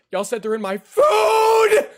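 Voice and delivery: high, slow, shouted panic; panicked shout. Real, not acted. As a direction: yell out in horror. Freaking out about bugs.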